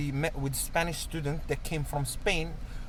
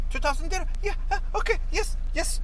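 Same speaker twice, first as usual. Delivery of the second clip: yes man; 13 semitones higher than usual. Retold or imitated. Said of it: "There's like".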